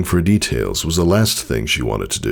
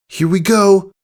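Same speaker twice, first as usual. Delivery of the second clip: much higher, louder excited shout; brave cry; 5 dB louder than usual. Acted. Filly diving at the cloud, excited cry. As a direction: psych yourself up and launch.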